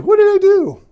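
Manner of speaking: very high, loud whiny protest; defensive kid. Acted. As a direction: squeal it like a wronged little boy. David defends himself to brother.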